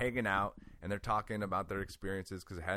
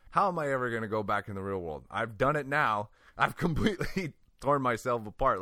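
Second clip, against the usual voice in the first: distraught; 4 semitones higher, 7 dB louder than usual. Retold or imitated. Retold panicked crying thoughts.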